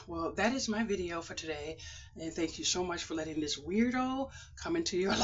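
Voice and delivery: ordinary delivery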